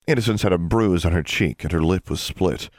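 plain reading voice

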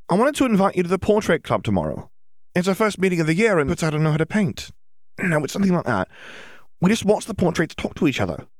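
put-on high, girlish inviting voice; high-voiced schoolgirl explaining her club; chatty schoolgirl Ginny. A narrator's reading. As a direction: pitch it far up, a girl making an invitation.

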